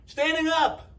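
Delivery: high, loud scream. A narrator's reading. One side screaming at the other, shrill and loud. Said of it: "One side screamed".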